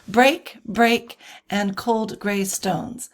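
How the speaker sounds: "Break, break" as two separate strong beats, then slow "cold gray stones"; spondaic stress. Shown as a demonstration. Teacher illustrating spondees, full stress on each "break". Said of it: "that's two stressed syllabus".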